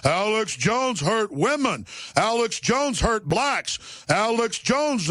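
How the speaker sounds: slow, high hypnotic chant; mock hypnotized viewer repeating the line; brainwashed subconscious. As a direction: drone it like a programmed mind. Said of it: "The subconscious just accepts".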